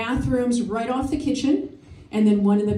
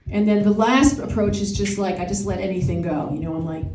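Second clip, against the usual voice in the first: usual speaking voice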